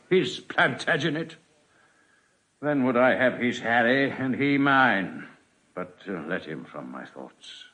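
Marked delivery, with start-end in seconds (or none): 5.7-7.6 much softer and lower, pushing the thought away; quiet dismissal. Acted. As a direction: drop it under your breath. King dismisses painful thought.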